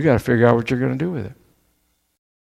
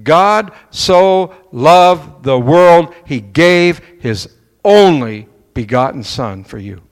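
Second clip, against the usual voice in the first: slow, weighty, emphatic reading of scripture; scripture quote; 4 dB louder, slower than usual. Acted. Preacher quoting John to the church, slow and solemn. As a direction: stretch every word out like a proclamation. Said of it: "John chapter three sixteen says".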